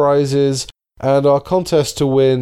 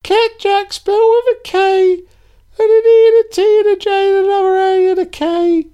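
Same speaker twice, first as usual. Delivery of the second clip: put-on very high, louder squeaky kid voice; eager golly-gee kid spelling; wholesome 1950s kid; 5 dB louder than usual.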